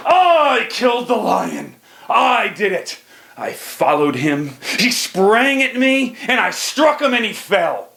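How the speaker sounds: high, slow proud boast of a caveman chief; boastful tribe leader. Acted. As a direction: crow about the kill like a proud chief. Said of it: "the leader of our tribe jumps up and he says".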